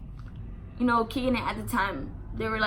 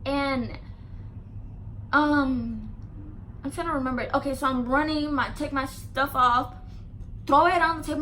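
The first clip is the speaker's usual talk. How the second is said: ordinary talk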